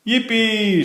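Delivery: put-on a kid's happy yippee; excited cheer. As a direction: cheer like a delighted kid. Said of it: "shouted Franklin".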